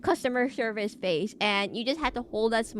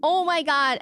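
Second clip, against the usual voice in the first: excited; 6 semitones higher, 6 dB louder than usual. Real, not acted. Excited news opener.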